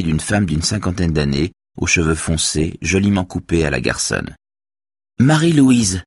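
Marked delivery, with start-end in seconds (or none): 5.2-6.0 high exclamation through a tight smile; strained smile. A narrator's reading. Woman finds her sister-in-law there, strained exclamation. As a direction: greet her with forced politeness. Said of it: "dit la femme avec un sourire crispé".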